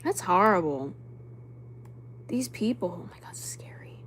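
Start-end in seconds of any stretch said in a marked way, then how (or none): 3.0-4.0 hushed, voiceless alarm; scared whisper. Real, not acted. Streamer reading about scary people, frightened whisper. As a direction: whisper it under your breath, spooked. Scared by what she reads.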